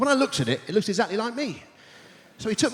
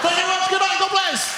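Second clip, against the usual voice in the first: shouted goodbye; 12 semitones higher than usual. Real, not acted. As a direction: belt out the sign-off to the crowd. Comedian's shouted sign-off.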